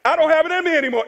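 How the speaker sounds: high, rushed complaint; exasperated mock protest. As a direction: whine it out fast, at wit's end. Objector protests he cannot forgive.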